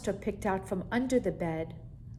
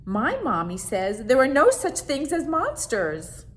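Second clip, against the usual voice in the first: put-on earnest boy; 6 semitones higher, 9 dB louder than usual. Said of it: "said Howard".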